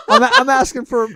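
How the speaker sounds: high, loud laughing. Pretend. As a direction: crack up while joking. Joking, laughing loudly.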